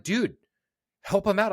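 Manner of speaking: half-shouted plea to help out; exasperated call. Retold or imitated. Friend urging teammate on stage, pleading call. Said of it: "I'm like".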